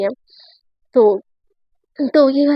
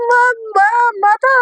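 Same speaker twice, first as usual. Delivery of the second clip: high, drawn-out yelp at no extra volume; mock cry out; 7 semitones higher, slower than usual. Satya pinched on the belly by Ayesha, mock crying out. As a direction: play-act a pained wail for mother. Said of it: "Satya एकदम चिल्लाती है".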